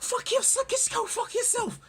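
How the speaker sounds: very high, strained mock outburst; mock hero retort. Mocking a hero's squeaky retort, very high. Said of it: "it's like".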